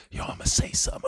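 whispered aside. Retold or imitated. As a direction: whisper it to the table. Awkward quiet dinner table.

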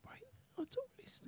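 high excited whisper; hushed alarm. Pretend. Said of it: "Why are we whispering?".

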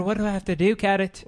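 put-on Irish accent. Fan begging the player in an Irish accent. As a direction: plead in the Irish voice. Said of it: "I can do Garrett Cooper".

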